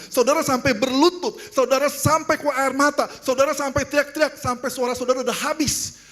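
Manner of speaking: high, impassioned preacher's voice; preaching. Real, not acted. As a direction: press it urgently on the congregation. Preaches with raised voice. (khotbah berapi-api dengan nada tinggi)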